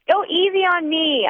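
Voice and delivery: put-on high drunken whine. A narrator's reading. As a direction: plead like a tipsy girl.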